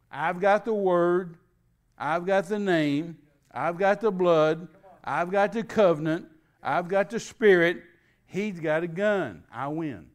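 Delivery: loud, slow, punched list; preaching declaration. Real, not acted. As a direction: declare each one like a victory.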